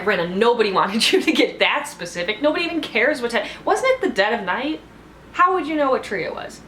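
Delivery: high-pitched, incredulous mock scolding; exasperated disbelief. Viewer scolding a player's over-detailed story, incredulous. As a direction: pile up baffled questions, pitch climbing.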